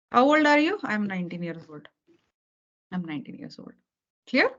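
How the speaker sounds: usual speaking voice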